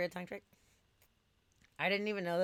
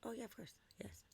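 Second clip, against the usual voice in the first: whispering; 8 semitones higher, 10 dB quieter than usual. Real, not acted. Casual whispered aside.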